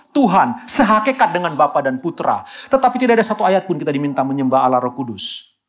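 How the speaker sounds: ordinary talk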